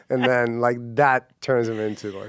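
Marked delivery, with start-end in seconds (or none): none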